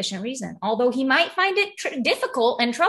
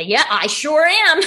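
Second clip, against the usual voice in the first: loud, slow, proud yes; proud emphatic admission; 4 semitones higher, 7 dB louder, slower than usual. Retold or imitated. Telling the neighbor loudly and slowly she's still alcoholic.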